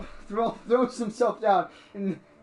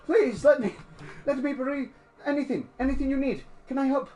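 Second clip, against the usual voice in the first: put-on high, pleading old-man voice; anxious groveler; 7 semitones higher than usual. Old man ignored by the Astartes, anxious offers of help. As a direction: beg to make amends, eager to serve.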